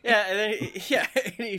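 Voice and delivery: amused, breaking into laughs mid-sentence; laughing while talking. Real, not acted. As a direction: tell it through real laughter. Cracking up while retelling the scene.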